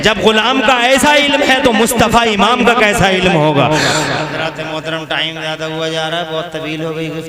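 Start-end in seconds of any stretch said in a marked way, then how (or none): 0.0-3.7 loud preaching, starting high and coming down; forceful preaching. Real, not acted. Preacher asking crowd about the Imam's knowledge, forceful preaching. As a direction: drive the rhetorical question home loudly.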